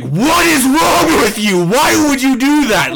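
high, loud, drawn-out angry shout; furious yelling. Retold or imitated. Father turning on his daughter after the fire, yelling furiously. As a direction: lose it completely at the kid. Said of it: "yelled at her".

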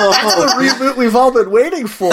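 bursting with laughter as she speaks; real laughter through the line; laughing. Real, not acted. Laughing at joke.

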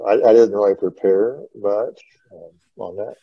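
usual speaking voice

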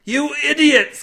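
high, loud outraged shout; angry roar. A narrator's reading. Cade to his deluded mates, roaring. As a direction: roar at a pack of fools. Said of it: "Cade roared".

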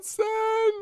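high wailing mock sob; hammed-up high-pitched crying. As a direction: wail the numbers in a put-on cry. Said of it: "This is me really crying".

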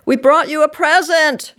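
high, loud, excited singsong; bright-pitched, eager chime; chiming announcement. A narrator's reading. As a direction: announce a surprise with glee. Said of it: "they chimed in unison".

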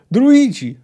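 loud, high yell to the druids. A narrator's reading. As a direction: shout it out over an uproar. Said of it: "wrzasnął Geralt". (głośny, wysoki wrzask)

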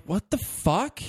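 replaying shock at the screen; retold disbelief. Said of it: "And I'm like".

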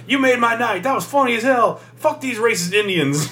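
put-on high laughing old woman voice; old lady shouting from her truck window; delighted old lady. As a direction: crow it out through laughter. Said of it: "she's like".

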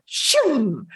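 whooshing "shoom" sound effect; drawn-out falling whoosh of spray; imitated extinguisher blast. Captain firing the extinguisher at the cigar, imitated whoosh.

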